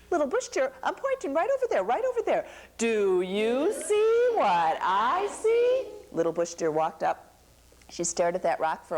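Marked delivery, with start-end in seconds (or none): 0.1-5.9 insistent Anansi. Acted. Anansi pressing Bush Deer to look, high and insistent.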